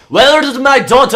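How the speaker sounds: demanding, stretched-out shout; angry yell. Acted. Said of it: "he yells out".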